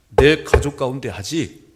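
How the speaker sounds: slow and loud, pressing each word; a slow, loud pastoral appeal; loud preaching. Acted. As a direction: plead with the congregation at full force. Preaching climax, loud plea.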